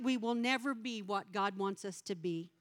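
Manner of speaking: ordinary talk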